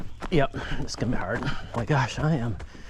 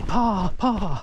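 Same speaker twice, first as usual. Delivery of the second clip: put-on child calling Pa; 6 semitones higher than usual. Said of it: "and say".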